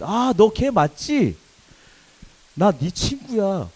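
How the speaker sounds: high, loud, drawn-out cry of recognition; excited recognition. Acted. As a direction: greet a long-lost friend with a big slow exclamation. Old friends suddenly recognise each other.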